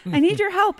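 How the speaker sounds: high, loud begging, played up; high-pitched acted appeal; retold urgent plea. Retold plea at brother's door.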